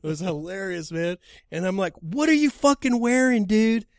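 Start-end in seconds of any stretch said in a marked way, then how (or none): 2.0-3.9 high, loud, exasperated question; retold screaming. Driver hunting for the fighter at the airport, screaming. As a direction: act out yelling the question down the phone. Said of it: "I'm screaming at him".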